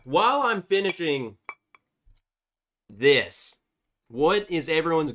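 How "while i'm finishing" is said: ordinary talk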